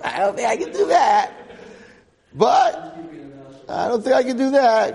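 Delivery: high, loud laughing aside. Real, not acted. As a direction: laugh through the joke about silence. Joking about a year's silence.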